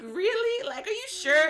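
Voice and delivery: put-on can't believe it's a boy; stunned disbelief.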